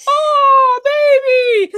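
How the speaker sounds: high, loud, weepy cry; mock wailing parent. Dad mocking an overreacting parent, high and wailing. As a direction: wail over the baby's tumble. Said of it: "But if you go".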